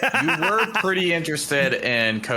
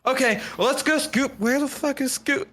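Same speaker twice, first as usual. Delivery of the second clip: much higher, excited Shaggy; yelling for his dog, pitched way up; 14 semitones higher than usual. Retold or imitated. Shaggy calling for Scooby, high and excited. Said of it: "And he goes".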